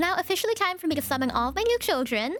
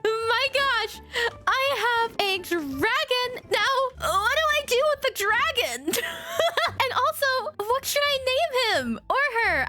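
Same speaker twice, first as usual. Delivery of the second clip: giddy excitement; 7 semitones higher than usual. Real, not acted. Just got a dragon.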